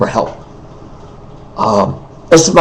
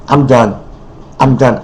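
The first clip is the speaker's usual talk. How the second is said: ordinary talk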